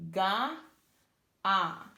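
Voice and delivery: ga then ah, each sound said separately and loud. Shown as a demonstration. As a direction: split "ga" and "ah" into two clear loud sounds. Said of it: "So you have".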